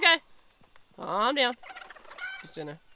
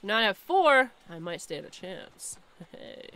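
usual speaking voice

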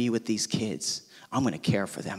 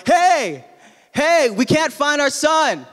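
high, loud frantic call; retold yell; 11 semitones higher, 4 dB louder, slower than usual. Wife yelling to the lifeguard, frantic and high. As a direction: shout for help, scared. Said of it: "My wife is yelling at the lifeguard".

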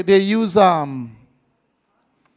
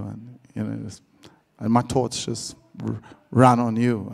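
much lower, hushed crooning; fake deep voice; 11 semitones lower, 9 dB quieter than usual. Man sweet-talking on the phone, very low hushed crooning. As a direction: keep the put-on deep voice going. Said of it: "That is not a real voice".

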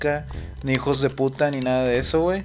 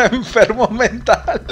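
higher, drawn out through laughter; laughing; 6 semitones higher, slower than usual. Retold or imitated. Streamer quoting dad's scolding, laughing. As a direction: crack up while quoting the insult.

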